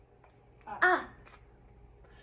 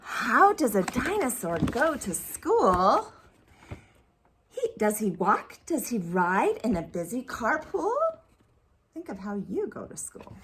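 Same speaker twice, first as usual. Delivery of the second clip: put-on reading to children; 2 semitones lower than usual. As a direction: read it like a picture book. Reads picture book aloud.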